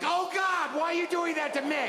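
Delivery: high-pitched dismayed outcry; appalled fan protesting; retold horror. Retold horror at the big screen.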